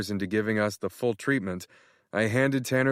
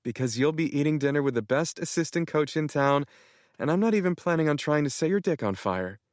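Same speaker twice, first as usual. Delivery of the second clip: put-on higher, playful young woman's voice; teasing girlfriend; 6 semitones higher than usual. Kennedy ribbing Matt about their date, playful. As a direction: tease him in her voice.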